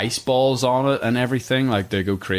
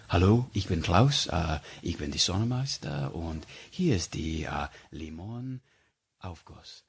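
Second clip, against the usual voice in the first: put-on German accent; 4 semitones lower, 7 dB quieter than usual. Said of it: "with his German accent and he would do".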